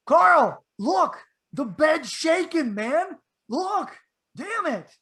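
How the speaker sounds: scared and desperate for him to look; frantic plea. Retold or imitated.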